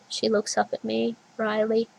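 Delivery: usual speaking voice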